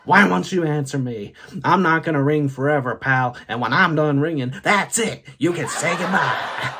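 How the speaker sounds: fed-up cell phone, loudly scolding; indignant ringtone. Retold or imitated. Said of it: "It goes something like this".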